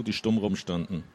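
plain reading voice

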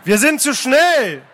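high, drawn-out call; called-out warning. Acted. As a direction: call out to the rowing crew. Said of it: "rief Phileason".

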